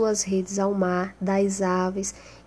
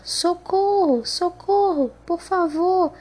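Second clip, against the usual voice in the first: high-pitched acted plea, only mildly raised volume; cry for help; 8 semitones higher than usual. A narrator's reading. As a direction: call out for help as a trapped character, pitched well up. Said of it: "Então começou a gritar". (grito de socorro encenado, em voz aguda)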